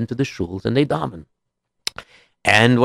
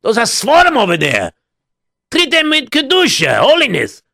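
character voice; 10 semitones higher, 3 dB louder than usual. Acted. Character warns about holy books.

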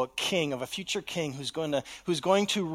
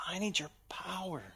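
breathy whisper, much softer; whispered plea; 10 dB quieter than usual. Acted. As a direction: confess it under your breath.

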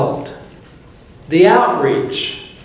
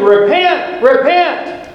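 high-pitched cry to repent; imitated yelling, pitched way up; mock preacher's yell; 9 semitones higher, slower than usual. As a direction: act out a wild street preacher calling out. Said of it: "He's yelling and screaming".

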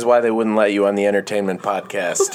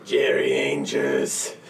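very low, slow and drawn out; deep slow announcer voice; 10 semitones lower, much slower than usual. Pretend. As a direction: drop deep and stretch each word. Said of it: "Do an impression".

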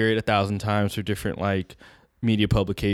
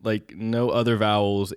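ordinary delivery

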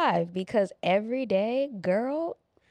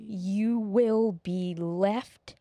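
very slow dramatic; much slower than usual. Acted. Drawn-out dramatic warning.

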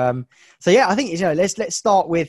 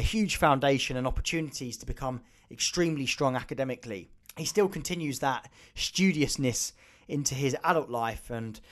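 smooth, even scripted intro read; podcast intro read; 2 semitones lower, 8 dB quieter than usual. Acted. Host introducing the guest to listeners, even scripted read.

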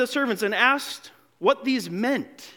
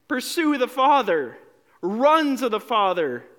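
normal talking voice

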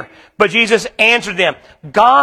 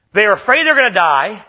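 ordinary talk, nothing marked

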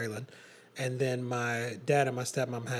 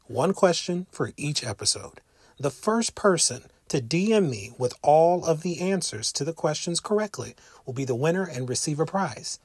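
podcast promo read; 5 dB louder than usual. Acted. Reads a listener contest promo.

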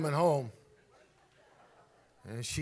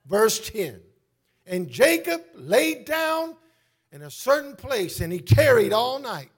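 high, loud preaching that builds to slow shouts; full-force preaching; 13 semitones higher, 15 dB louder than usual. Real, not acted. Preacher telling the church God's promise to Jacob, full-force preaching. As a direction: proclaim the scripture, stretching the key words.

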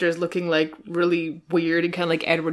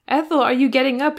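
called out high and loud; shout; 7 semitones higher, 6 dB louder than usual. Acted. Mister Saltina calling to Ethel in the next room, shouting. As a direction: call through the wall. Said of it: "shouted Mister Saltina".

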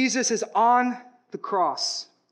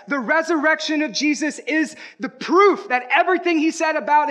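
louder, higher victorious proclamation; triumphant preaching; 6 semitones higher, 4 dB louder than usual. Acted.

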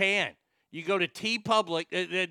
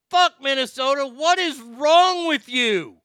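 much higher and louder, a full angry yell; furious rant; 9 semitones higher, 12 dB louder than usual. Pretend. Caller yelling into the mall feedback line in mock fury.

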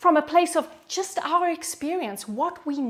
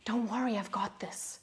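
hushed reassurance, very soft; whispering; 2 semitones lower, 11 dB quieter than usual. Acted. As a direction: soothe someone overwhelmed in a near-whisper. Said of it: "He's whispering to the overwhelmed".

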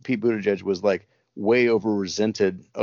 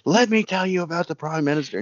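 higher-pitched mock rant, imitated; mock yelling comedian; 9 semitones higher than usual. Retelling a comedian yelling at the camera, hammed-up rant. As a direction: ham up a comedian ranting at the camera. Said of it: "he yells at the camera".

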